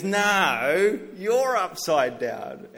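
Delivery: put-on loud, slow, insistent kid's "No, you're upside down"; shouting kid retort. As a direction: belt it back like a stubborn eight-year-old. Son shouts back louder.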